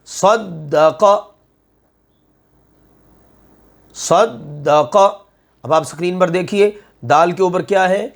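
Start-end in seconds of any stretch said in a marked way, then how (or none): 0.1-5.0 صدق slow, with the doubled د pressed hard and held; tajweed recitation. Shown as a demonstration. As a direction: stretch صدق, voice never breaking on the shadda. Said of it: "اس کی مشق". (صدق کو آہستہ، شد پر سختی سے رک کر)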